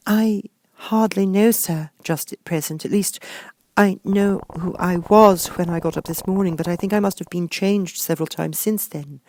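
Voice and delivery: put-on high, shy young girl voice. A narrator's reading. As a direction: answer timidly, high and light. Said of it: "Alice replied rather shyly".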